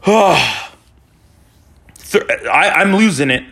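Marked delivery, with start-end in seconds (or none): 0.1-0.6 long exasperated exhale; heavy sigh. Real, not acted. Exasperated pause after describing goal against.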